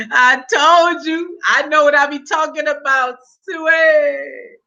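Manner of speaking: high, stretched-out gloating; crowing triumph. Real, not acted. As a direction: crow it, dragging out the last word. Crowing over being proved right.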